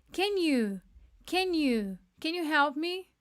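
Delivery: "can you" said as ken-u, blended; "can you help me" with the contraction; contracted can you. Shown as a demonstration. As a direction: run "can you" together quickly. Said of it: "essa contração".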